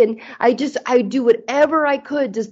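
normal talking voice

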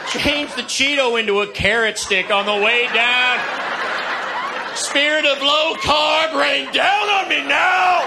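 building from earnest plea to shouting; fervent prayer to shouting. Acted.

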